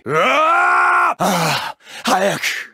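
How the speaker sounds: very high, long drawn-out howl; desperate pleading cry; anguished scream. Acted. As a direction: howl out the despair. Begging to be killed in battle.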